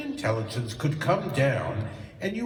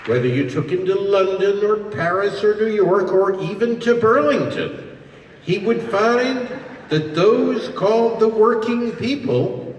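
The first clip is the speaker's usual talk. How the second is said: louder, rising oratorical push; ringing oratory; 2 semitones higher, 7 dB louder than usual. Real, not acted. Speaker restarting his point to the crowd, louder. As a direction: hammer it out to the whole hall.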